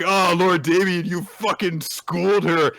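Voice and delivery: high, loud fawning cheer; excited lackeys. Retold or imitated. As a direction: hype up your boss. Crew praising Damien.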